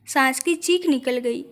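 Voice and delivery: ordinary delivery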